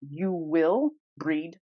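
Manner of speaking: ordinary talk, nothing marked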